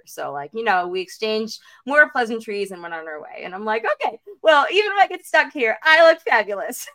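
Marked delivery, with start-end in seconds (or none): 4.0-6.9 higher, louder, sassy self-assurance; sassy self-talk. Retold or imitated. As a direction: brag to yourself with a grin. Her sassy inner thought.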